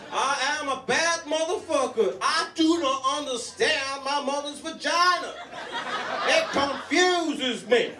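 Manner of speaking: strut it out, proud and silly; Samuel L. Jackson. Retold or imitated. Comic imitating a movie tough guy bragging, high and hammed-up. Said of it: "that's not a thing to brag about".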